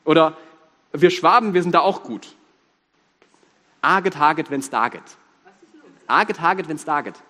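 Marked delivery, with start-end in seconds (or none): 3.8-5.0 lightly voiced Swabian mumble, words clipped short; Swabian dialect. Shown as a demonstration. Swabian preacher teases the congregation with dialect. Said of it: "Kann's mir jemand übersetzen?". 6.1-7.2 lightly voiced Swabian mumble, words clipped short; Swabian dialect. Shown as a demonstration. Swabian preacher teases the congregation with dialect. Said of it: "Kann's mir jemand übersetzen?".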